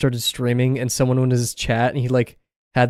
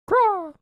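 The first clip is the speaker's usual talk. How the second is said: high squawking crow caw; crow impression. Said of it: "I think that was a pretty good crow impression".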